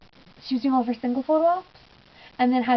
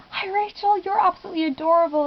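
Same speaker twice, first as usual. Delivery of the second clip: gushing fan greeting; 8 semitones higher than usual. Retold or imitated. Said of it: "I was just like".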